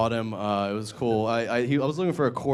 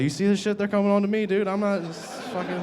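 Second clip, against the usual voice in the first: high panicked protest; 11 semitones higher than usual. Retold or imitated. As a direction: voice shot up high, flustered and defensive. To bus driver, panicked.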